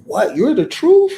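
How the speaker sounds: mock a startled listener; surprised disbelief. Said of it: "Now you're like".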